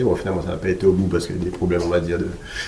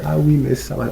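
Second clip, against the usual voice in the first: mock fussy client, pitched up high; nitpicking; 8 semitones higher than usual. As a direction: imitate a picky buyer in a higher voice. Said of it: "pas à chipoter en disant".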